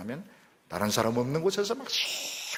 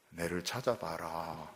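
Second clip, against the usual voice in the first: slow, hushed playful call; softer, slow teasing call; 5 semitones lower, 8 dB quieter, slower than usual. Retold or imitated. God hiding behind the door, softly teasing his people. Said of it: "왜 그렇게 하십니까?".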